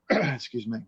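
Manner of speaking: normal talking voice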